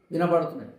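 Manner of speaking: ordinary talk, nothing marked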